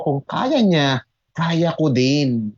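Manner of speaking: slow resolve. Shown as a demonstration.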